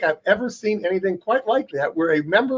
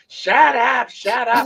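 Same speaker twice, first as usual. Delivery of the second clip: imitating the congresswoman; 5 semitones higher, much slower than usual. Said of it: "telling the press to shut up".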